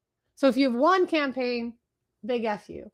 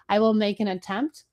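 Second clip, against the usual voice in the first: usual speaking voice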